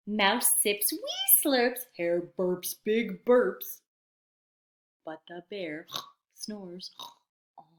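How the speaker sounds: ordinary delivery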